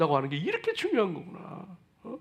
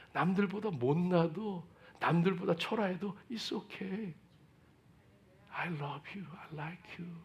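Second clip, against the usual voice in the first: tender voice of God; 3 semitones lower, 10 dB quieter than usual. Acted. God reassuring a struggling believer, soft tender voice. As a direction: hush down and comfort like a loving father. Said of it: "그런 모습임에도 불구하고".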